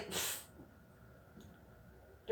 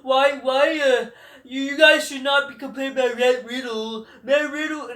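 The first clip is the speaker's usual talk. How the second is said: high, loud whiny mock voice, much higher than his own; mocking whiny wrestler; 6 semitones higher, 7 dB louder than usual. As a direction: sneer it like a preachy veteran scolding fans. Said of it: "They go".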